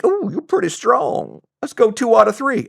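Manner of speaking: put-on Southern voice, high and swooping; Southern accent. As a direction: play an impressed Southern gent, pitched high. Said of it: "I don't have a very good Southern accent".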